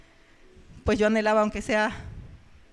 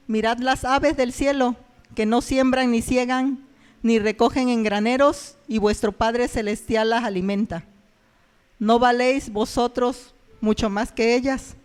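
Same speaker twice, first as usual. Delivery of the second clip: louder, slow, solemn Bible reading; scripture reading; 5 dB louder than usual. Shown as a demonstration. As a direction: read the verse aloud like in church, louder and slow. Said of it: "Donde dice la palabra de Dios".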